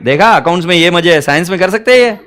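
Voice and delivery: ordinary delivery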